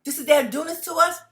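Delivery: loud, high-pitched outraged quote; indignant disbelief. Acted. Voicing viewers looking at police abuse, indignant disbelief. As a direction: voice shocked protest, pushed up high. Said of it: "If you looking at them like".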